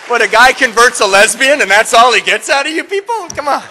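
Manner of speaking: high, loud demand for applause; mock-outraged shout. Real, not acted. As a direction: chide the crowd at full voice. Demands more applause from audience.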